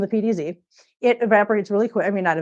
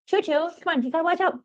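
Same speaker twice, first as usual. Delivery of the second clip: higher, sing-song talk to a cat; pet voice; 7 semitones higher than usual. Real, not acted. Owner warning the cat Choo Choo, in a higher pet voice. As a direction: coax the cat playfully.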